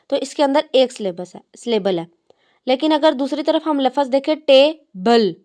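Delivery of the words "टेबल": टेबल split in two, pause before the last syllable; broken into syllables. Shown as a demonstration. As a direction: say टेबल as two separate beats. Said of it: "अगर हम उसको अलहदा-अलहदा करें".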